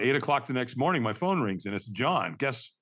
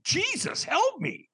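very high-pitched, frantic begging; desperate plea; slower than usual. Retold or imitated. Newly sober man begging his caller, desperate. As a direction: beg for help at your wit's end. Retold plea for help on phone.

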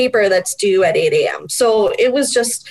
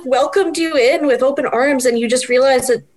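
normal talking voice

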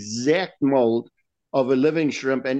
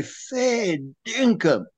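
drawn-out, higher, slow "Fair dinkum", the dinkum falling; incredulous fair dinkum; 7 semitones higher, much slower than usual. Retold or imitated. Mock Aussie mate doubting a fish story, slow incredulous. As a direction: stretch "Fair dinkum" out high and slow in mild disbelief. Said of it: "he'll say".

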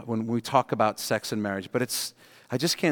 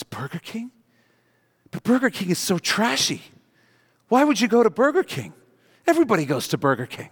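higher, scornful Burger King outrage; disgusted wife; 7 semitones higher than usual. Acted. Wife scorning husband's Burger King meal, indignant and higher. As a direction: sneer at the cheap food.

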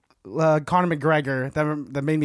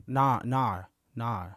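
quiet, low "nah" in mock Australian; English or Australian accent; 8 semitones lower, 8 dB quieter, much slower than usual. Asking cohost about English or Australian accent, quietly imitating. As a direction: try a soft Australian "nah". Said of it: "English accents or Australian accents".

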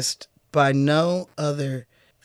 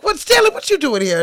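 surprised Pops voice; 8 semitones higher than usual. Retold or imitated. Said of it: "And he's like".

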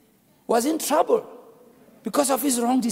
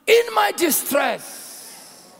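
slow, drawn-out, higher opening; dramatic reading; 7 semitones higher, much slower than usual. Acted. Preacher opening the psalm, slow dramatic reading. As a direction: stretch each word out.

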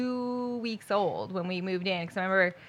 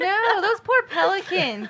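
high laughing mock pity; giggling over the poor pelicans; 10 semitones higher, 4 dB louder, slower than usual. Real, not acted.

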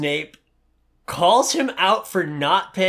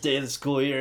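normal talking voice

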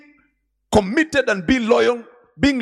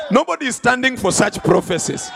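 normal talking voice

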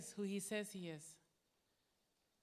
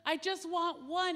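asking father; 7 semitones higher, 6 dB louder, slower than usual. Retold or imitated.

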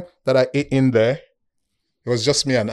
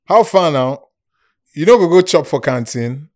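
louder, higher Nigerian Pidgin greeting; 4 semitones higher, 7 dB louder than usual. Retold or imitated. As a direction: imitate friends speaking Nigerian Pidgin. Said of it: "so they will speak pidgin".

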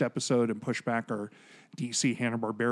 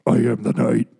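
low, loud gruff Batman growl; 3 semitones lower, 8 dB louder than usual. Pretend. Doing Batman after replacing talk.

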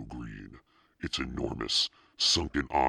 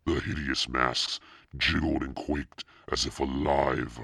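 usual speaking voice

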